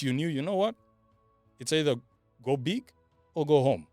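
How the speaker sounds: ordinary talk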